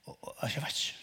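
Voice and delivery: hushed unvoiced aside; half-spoken whisper. Real, not acted. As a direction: drop to a whisper.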